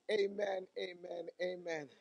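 normal talking voice

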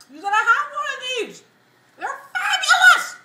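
loud, high, gushing through food; excited shout with mouth full. Real, not acted. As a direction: rave about it, cheeks stuffed. Excited about the wings, mouth full.

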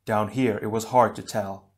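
ordinary delivery, nothing marked

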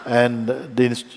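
normal talking voice

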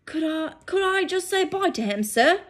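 high, pleading giant's voice; Hagrid pleading. Acted. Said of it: "said Hagrid".

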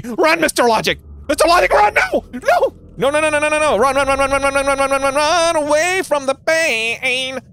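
high, loud panicked chant of run; mock panic. Real, not acted. Streamer urging Mister Logic to run, in hammed-up panic. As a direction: yell at him to flee in mock terror.

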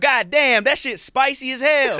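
high, loud bro voice pleading; trapped voice. Acted. A trapped soul complaining about spice, high and loud. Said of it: "all the voices are trapped inside".

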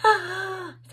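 high, slow, breathless gasp of delight; thrilled squeal-gasp at the delivery; excited gasp. Real, not acted. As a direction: gasp like the package just arrived. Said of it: "I'm, like, super excited".

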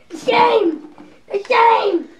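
loud, hammy repeated scold; mock scolding. Host scolds the contestant, mock shouting. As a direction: wag the finger with your voice.

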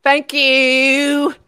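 high, loud, drawn-out thank-you. Acted. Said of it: "that's my [name] impression".

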